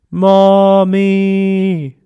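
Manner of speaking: long, high, loud drawn-out Mommy; long call. Retold or imitated. Child keeps calling mom in the night, long high cry. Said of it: "Just a little more".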